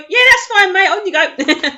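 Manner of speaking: high, bright and airy, then slowing; breezy dismissive. Retold or imitated. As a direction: brush it off cheerfully. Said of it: "you could just go".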